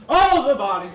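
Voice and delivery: "Oh" burst out as it dawns; sudden realization. Acted. Dann Erkenntnis, laut.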